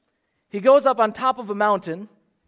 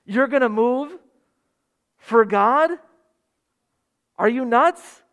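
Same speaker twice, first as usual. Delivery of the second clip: mock incredulous; 4 semitones higher than usual. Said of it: "We make rational judgments about".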